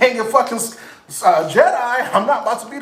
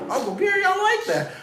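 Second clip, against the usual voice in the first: pleased laughing; 12 semitones higher, 4 dB quieter than usual. Retold or imitated.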